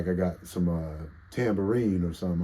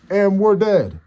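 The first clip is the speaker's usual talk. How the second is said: much higher, loud mock announcement; phone voice mock; 13 semitones higher, 13 dB louder, slower than usual. Streamer voicing his phone saying the stream died, loud. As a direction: shout it like the phone dying. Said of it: "This bitch is like".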